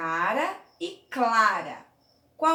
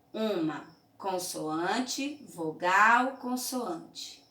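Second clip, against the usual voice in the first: slow pattern readout; much slower than usual. Shown as a demonstration. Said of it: "Aqui eu percebo que eu tenho".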